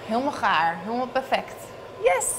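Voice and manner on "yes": excited. Real, not acted. Brownie turns out perfect.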